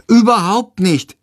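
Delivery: put-on high, childish grumble; griping. A narrator's reading. Little brother denies being scared, griping high. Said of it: "meckert Tom zurück".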